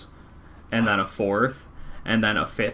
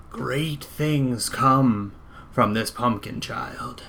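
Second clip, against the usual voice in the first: slow prophetic voice; 2 semitones higher, much slower than usual. Acted. NPC speaks a prophecy.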